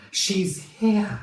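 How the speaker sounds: high, loud exclamation; excited realisation. A narrator's reading. Fred realises his mother has arrived, exclaiming excitedly.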